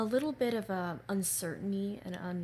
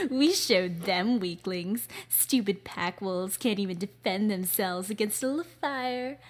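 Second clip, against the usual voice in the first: sinister antagonist; 7 dB louder than usual. Acted. Villain mocking pack wolves, loud sinister sneer. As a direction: gloat and stretch out the taunt. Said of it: "deep female vocals and a lot of sinister tones".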